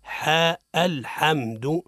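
الحمد slowly, ح with a plain open "a"; name the حاء, then say الحمد evenly drawn out; correct ha. Shown as a demonstration. Teacher corrects the ha mistakes, slow balanced ha. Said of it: "الصواب هو".

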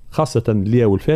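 usual speaking voice

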